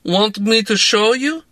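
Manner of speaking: put-on dry jab in a Russian accent. A narrator's reading. Drunk woman mocking her boss, in a Russian accent. Larissa voice.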